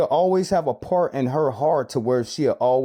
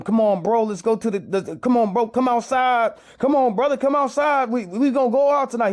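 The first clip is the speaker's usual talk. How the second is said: higher, coaxing call of the friends; hyped-up buddies; 7 semitones higher than usual. Retold or imitated. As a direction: pull him out for a night on the town. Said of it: "the brothers was telling him".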